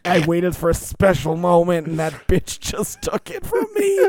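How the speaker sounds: [name] sobbing about her stolen bottle, hammed up; crazy weeping. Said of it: "crazy weeping".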